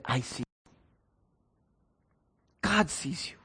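very soft, low, gentle voice; hushed, intimate assurance; tender reassurance. Acted. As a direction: offer quiet comfort, leaving space between phrases. Imagined student comforting unseen girl.

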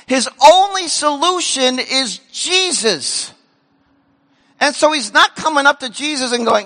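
ordinary talk, nothing marked

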